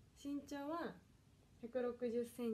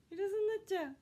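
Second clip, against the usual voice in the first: high, loud flustered wail; panicking that the parcel will be missed; loud high panicked cry; 8 semitones higher, 8 dB louder, faster than usual. Real, not acted. Panics about missing the delivery.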